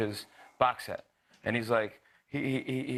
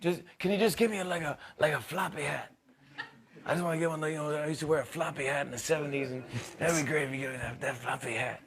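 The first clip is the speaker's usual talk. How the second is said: [name] impression; 4 semitones higher than usual. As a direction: ask for the floppy hat in a lightly voiced Bruce. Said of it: "He goes".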